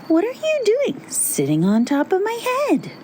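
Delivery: very high, incredulous question; high-pitched storybook pumpkin; indignant little pumpkin. A narrator's reading. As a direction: ask it in a high, swooping character voice. Pumpkin questions crow on head.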